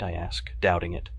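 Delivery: usual speaking voice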